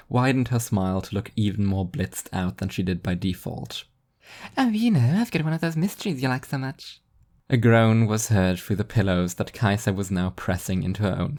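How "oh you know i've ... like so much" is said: high, sing-song airy angel; bubbly and blissfully oblivious; cheery angel voice. A narrator's reading. Spaced-out angel teasing the sleepy devil, high and chirpy.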